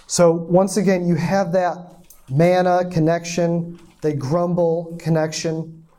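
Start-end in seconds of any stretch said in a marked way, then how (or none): none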